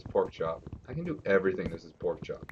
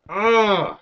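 high, loud exasperated ugh; loud frustrated groan; 11 semitones higher, 10 dB louder than usual. Real, not acted. Player fed up with missing coal, loud groan.

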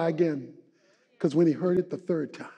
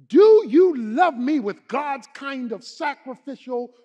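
emphatic questioning; 6 semitones higher, 7 dB louder, slower than usual. Retold or imitated. Voicing Jesus asking Peter.

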